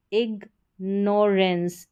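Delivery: Ignorance slowly split as "ig - norens"; syllable by syllable. Shown as a demonstration. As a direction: break ignorance into slow teaching chunks. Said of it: "उच्चारण एंस करके होगा".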